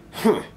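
high loud indignant humph; rude humph. Acted. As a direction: huff at him rudely. Spencer's rude huff.

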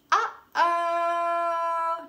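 loud mock alarm for children; hammed-up oops to the kids; playful uh-oh.

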